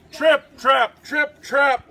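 loud, stomping trip-trap; big hoof steps. A narrator's reading. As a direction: pound out each word like heavy hooves. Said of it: "went his big hooves".

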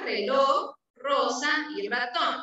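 normal talking voice

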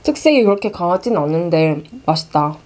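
talking while chewing noodles; chewing between words; mouth full. Real, not acted. Seen 0: Just ate noodles; closed-mouth chewing while talking, then lifts more.

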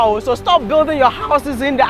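ordinary delivery

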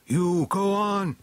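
high, drawn-out urgent call; crying out. A narrator's reading. Beastman sending companions ahead, crying out. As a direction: send them on with a high call. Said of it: "he cried".

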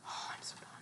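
defeated whisper. Real, not acted. Gamer giving up mid-fight, whispered. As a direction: breathe it out, giving up.

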